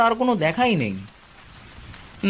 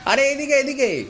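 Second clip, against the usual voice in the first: calling across the road, raised a bit; friendly call out; 6 semitones higher than usual. Acted. Raghab calls out to Shyamalda passing the tea stall, cheerfully. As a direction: hail a neighbour passing by.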